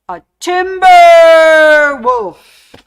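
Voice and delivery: long, very loud, high "timber!" call. Acted. Host delivers the wolf pun punchline as a loud, drawn-out timber holler. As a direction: holler it like a falling tree warning. Joke punchline called like a lumberjack.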